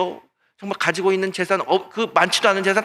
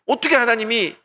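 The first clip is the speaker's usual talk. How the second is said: usual speaking voice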